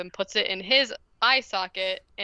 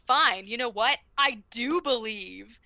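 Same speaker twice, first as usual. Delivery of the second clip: facetious belief; 2 semitones higher than usual. Retold or imitated. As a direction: sarcastically pretend to give in. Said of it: "facetiously is like".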